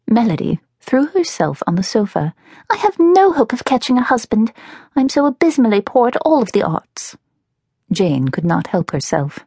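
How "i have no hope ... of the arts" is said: put-on high-pitched, self-pitying girl character; despairing younger sister. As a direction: lament to your sister in a higher voice. Narrator voices Melody despairing.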